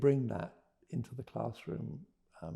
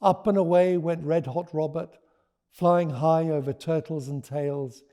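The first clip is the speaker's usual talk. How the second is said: lively read-aloud voice; story narration; 5 semitones higher, 10 dB louder than usual. A narrator's reading. Author reading her book to listeners, lively. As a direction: read it to a roomful of children.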